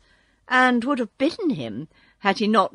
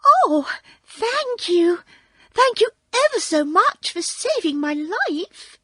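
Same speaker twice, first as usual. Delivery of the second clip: squeaky little mouse; 12 semitones higher, 4 dB louder than usual. A narrator's reading. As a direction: squeak out thanks like a tiny field mouse. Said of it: "in a squeaky little voice".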